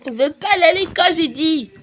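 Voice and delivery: put-on a milder version of the toddler voice; little girl digging in her heels; stubborn toddler. Toddler Sophie insists.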